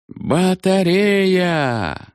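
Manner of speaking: high, slow stretched-out command; drawn-out shout. A narrator's reading. Battery commanders calling out, high and slowly stretched. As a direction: drag the word out across the field. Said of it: "крикнули двенадцать командиров батарей".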